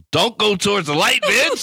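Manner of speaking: very high comic yelp; mock urgent cry. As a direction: call out in hammed-up panic. Mock calling to dazed partner.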